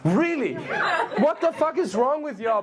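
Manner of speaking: normal talking voice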